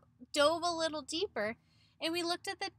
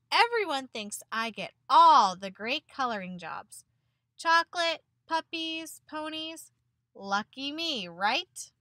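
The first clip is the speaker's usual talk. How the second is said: mock-cheerful sarcasm, louder at first; sarcastic; 8 dB louder than usual. Teacher reading an upset crayon's letter, sarcastic. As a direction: play the lucky line bitterly, tongue in cheek. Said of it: "He actually sounded a little upset".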